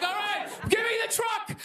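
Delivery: high-pitched, hammed-up panicked wail; mock crying. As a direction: beg to quit in a put-on sobbing yell. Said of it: "crying in the fetal position".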